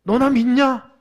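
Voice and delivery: loud, forceful divine scolding; stern rebuke. Acted. Preacher voices God confronting doubters, loud stern rebuke. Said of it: "따끔하게 얘기합니다".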